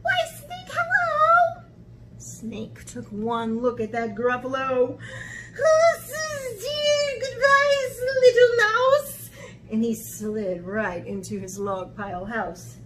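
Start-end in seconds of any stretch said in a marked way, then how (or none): 0.0-1.6 very high sing-song greeting; cheery mouse. Acted. Mouse greeting snake before Gruffalo, high and breezy. 5.6-9.3 high, loud, drawn-out alarm; terrified snake. Acted. Snake fleeing at sight of Gruffalo, high panic.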